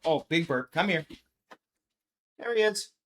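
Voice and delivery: ordinary delivery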